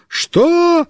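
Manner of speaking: high calling shout, can't hear. Acted. Mishka running after the car yells back, shouting. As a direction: yell back across the yard. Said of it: "кричит".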